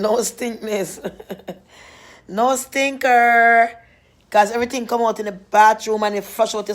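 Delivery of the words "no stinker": No stinker stretched long and loud; drawn-out no stinker. Acted. Teasing viewers about belly cleanse, drawn out.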